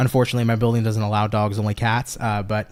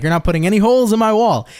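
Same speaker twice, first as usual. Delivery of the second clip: higher-pitched mock Portuguese accent; Portuguese-accented dad impression, pitched up; dad's Portuguese accent; 7 semitones higher, 3 dB louder than usual. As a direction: mimic dad laying down the law in a Portuguese accent. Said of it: "With his little Portuguese accent".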